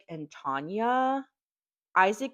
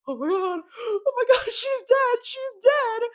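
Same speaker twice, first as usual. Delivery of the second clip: high, loud panicked wailing; panicked parents; 10 dB louder than usual. Retold or imitated. Parents watching Lacey die, panicked high wail. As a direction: shriek it like parents watching a death.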